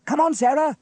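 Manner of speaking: high, loud call to a dawdling sister. A narrator's reading. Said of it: "called James".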